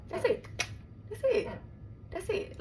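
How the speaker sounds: sharply high, soft that's it; mock high that's it. Comparing the betrayal to Paid in Full, high and soft. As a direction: squeak it out, mock-knowing. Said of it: "just think of the movie Paid in Full".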